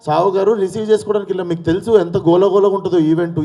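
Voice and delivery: pleading his case, voice climbing; agitated insistence. Real, not acted.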